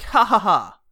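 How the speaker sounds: mocking fake laugh; a taunting ha ha ha. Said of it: "It feels like".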